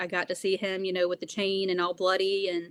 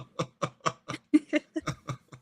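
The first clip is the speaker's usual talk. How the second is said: laugh. Real, not acted. Laughing at own remark.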